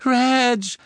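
high cry of relief; shout. Acted. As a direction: call his name out. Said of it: "schrie Misses Cattermole".

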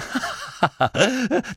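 short high giggle; a flustered little laugh; embarrassed high chuckle; nervous laugh. A narrator's reading.

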